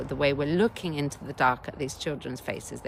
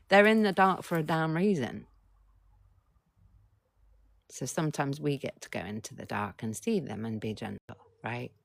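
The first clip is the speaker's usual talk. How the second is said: normal talking voice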